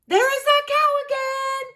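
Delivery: high, loud cheerful voice; Bean. A narrator's reading. Bean hearing the cow again, high and loud. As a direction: announce the cow. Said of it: "he says".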